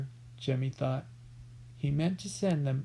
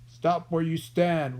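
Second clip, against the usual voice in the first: slow, raised warning; 5 dB louder, slower than usual. A narrator's reading. Billy to the hidden boys, slow raised warning. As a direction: warn them menacingly. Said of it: "warned Hold-your-nose Billy".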